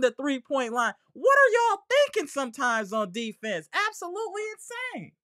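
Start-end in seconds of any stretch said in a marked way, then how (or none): 1.1-5.1 incredulous squeal. Real, not acted. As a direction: squeeze it out high and small in disbelief. Incredulous at the team's defense.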